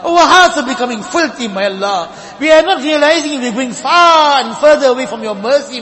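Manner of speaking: ordinary delivery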